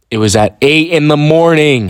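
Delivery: outraged complaint about the hour; exasperated outburst. Real, not acted. Ranting to viewers about the early class, loud and exasperated.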